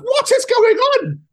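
high, slow, baffled "what is going on"; bewildered. Retold or imitated. Retelling watching the fight, high slow bewilderment. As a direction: stretch it out in disbelief. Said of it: "I was like".

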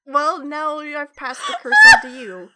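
loud, laughing through the words. Real, not acted. Passing the curse on to her cohost, laughing. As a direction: say it loudly through real giggles.

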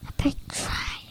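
breathy whisper. Real, not acted. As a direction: whisper it secretly. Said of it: "You don't have to whisper".

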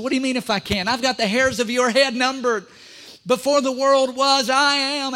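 a rising, booming rebuke; perturbed Jesus. Acted. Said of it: "might have been a little bit perturbed".

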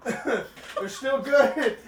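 slow, strained words through burning mouth; laughing through spicy heat; mouth burning from spicy food. Real, not acted. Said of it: "My eyes are watering".

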